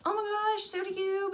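acted starstruck gush; gushing fan. Fan returns the star's compliment, high gushing.

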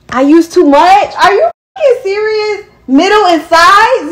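outraged at being told it's your fault; incredulous outrage. Real, not acted. Outraged at the instructions.

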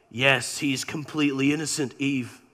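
low, quiet God; God gently telling Eve, low and soft; God's voice. Retold or imitated.

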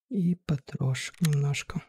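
soft and slow; quiet. Real, not acted. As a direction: murmur it slowly under breath. Quiet looting in raid.

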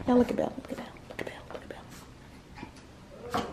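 hushed whisper. Real, not acted. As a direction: whisper so she won't wake. Whispering about Belle.

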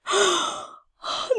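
real high-pitched screaming celebration; freaking out, overjoyed. Real, not acted. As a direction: shriek with joy, gasping between bursts. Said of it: "I am actually freaking out".

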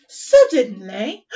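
dramatic suddenly. Acted. Teacher dramatises story word.

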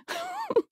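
a high, bright burst of laughter; amused high laugh. Acted. Mother laughs at own joke.

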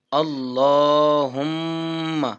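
আল্লাহুম্মা slow, the ম্মা held with ghunna nasal hum; nasalized recitation. Shown as a demonstration. Teacher demonstrating ghunna, slow nasal chant. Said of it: "একটু গুন্না করতে হয়".

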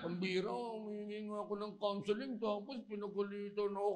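hammed-up quiet grievance; mock whining complaint. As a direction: moan it like a wronged church member. Acts a scolded member complaining.

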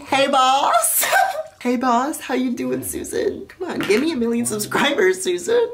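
high, playful begging with lifted endings; mock wheedling. Begging the boss for a million subscribers, mock wheedling. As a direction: pitch it high and cajole cheekily. Pretend plea to Susan Wojcicki.

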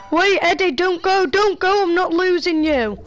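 high, loud desperate pleading; pleading shout. Real, not acted. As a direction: beg him loudly not to go. Begging teammate not to leave.

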